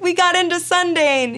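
high, loud overjoyed squeal; excited crying out. Retold or imitated. Wife celebrating Sundance news with husband, overjoyed. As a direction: replay it as a jubilant cry. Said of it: "I was so excited".

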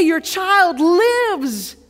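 high, loud, drawn-out proclamation; jubilant announcement. Acted. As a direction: call out the good news, stretching it slowly. Servants bring the father joyful news.

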